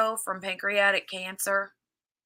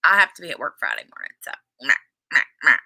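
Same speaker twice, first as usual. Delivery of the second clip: imitating shrugging; 7 semitones lower, 4 dB louder, much faster than usual. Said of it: "Abigail's like".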